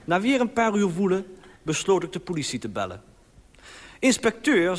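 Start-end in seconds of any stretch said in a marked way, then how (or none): 4.0-4.8 high, raised address to the inspector; addressing the police. Retold or imitated. Caller addresses the police officer, raised voice. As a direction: hail the inspector urgently. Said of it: "zei ik tegen de agent".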